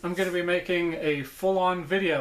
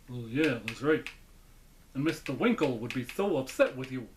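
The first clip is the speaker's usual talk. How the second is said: low puppet voice; Knucklehead Smith; 3 semitones lower than usual. Acted. Puppet answering his owner, low dummy voice. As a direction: dim ventriloquist dummy, pitched down. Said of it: "I finally got the voice right".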